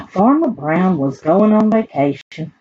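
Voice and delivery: usual speaking voice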